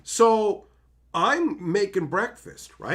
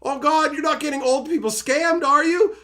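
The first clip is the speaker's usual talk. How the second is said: worried; 8 semitones higher, 4 dB louder than usual. Retold or imitated. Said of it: "I'm just like".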